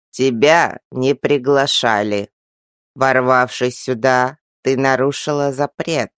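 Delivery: put-on slow, low creaking old voice; very old man. A narrator's reading. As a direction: creak it out like an ancient tree. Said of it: "снова проскрипел тот старик".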